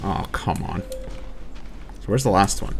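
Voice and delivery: ordinary talk, nothing marked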